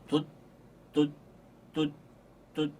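short detached 돛; 돛 clipped off each time; staccato. Shown as a demonstration. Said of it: "이런 발음을". (짧게 끊어서 돛)

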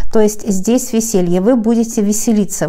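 normal talking voice

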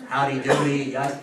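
normal talking voice

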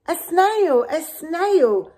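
high, loud alarmed cry; the teacher spots a snail, horrified; shocked shriek. Acted.

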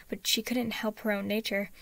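ordinary delivery

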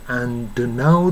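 "and now" with a separate, audible d; careful "and now", d and n kept apart; clearly released d. Shown as a demonstration. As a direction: pronounce the d fully before the n in "and now". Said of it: "doとnuははっきり発音して".

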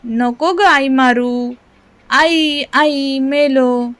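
mild cry of pain. A narrator's reading. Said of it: "मी ओरडू लागलो".

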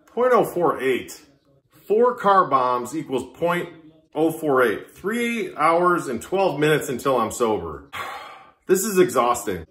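ordinary talk

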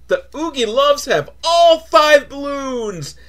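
hammed-up kids-show cheer; mock triumphant announcement. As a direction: proclaim it like a children's host.

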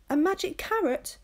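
high, excited question; eager interruption. A narrator's reading. Zelda cuts into the story, high and excited. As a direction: jump in, thrilled at the idea. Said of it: "interrupts Zelda".

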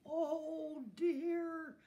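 high, loud, stretched dismay; alarmed storybook yell; cry of alarm. A narrator's reading. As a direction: wail it out as disaster looms. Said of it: "cried Santa in alarm".

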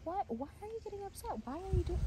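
high-pitched mock questioning; exaggerated high imitation of a prodding person; mimicked questioner. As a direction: imitate someone needling with questions, voice way up. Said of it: "I was like".

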